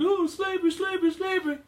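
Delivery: high, loud mocking lament over slavery; mock wailing. Host mocking mourners with a high, loud wail. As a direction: ham up a whiny wail, head down. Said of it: "you're having your head down and tucked".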